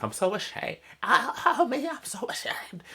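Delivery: high, strained breathless Marge voice; Marge Simpson impression. Recapping a Simpsons episode, doing Marge up high. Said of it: "That's my best Marge Simpson impression".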